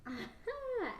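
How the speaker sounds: sudden high-pitched laugh. Real, not acted. Laughs at the question.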